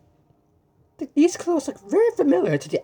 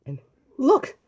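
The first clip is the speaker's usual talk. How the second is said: excited look; 7 semitones higher than usual. Acted. Showing photo.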